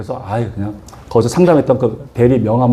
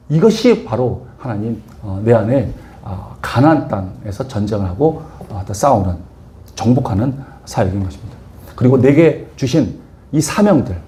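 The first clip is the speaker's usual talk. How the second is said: solemn slow preaching; 4 semitones lower than usual. Real, not acted.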